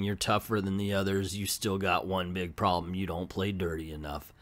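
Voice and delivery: soft menace. Retold or imitated. Cowboy menaces the beaten Harpy.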